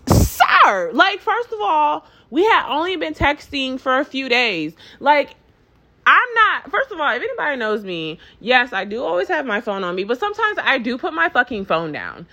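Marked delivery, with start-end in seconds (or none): none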